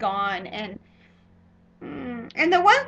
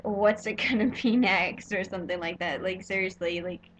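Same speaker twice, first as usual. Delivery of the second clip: ordinary talk, nothing marked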